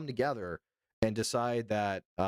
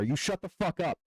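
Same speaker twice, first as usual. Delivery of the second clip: mock angry yell; 8 semitones higher than usual. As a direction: snap at the chatter in playful fury. Mock yelling at chatter.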